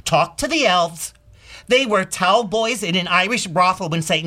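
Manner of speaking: rousing, emphatic testimonial in a high-pitched theatrical voice; Comet monologue. Acted. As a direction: preach it like a staunch believer. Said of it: "what he would say about what Blitzen has just said".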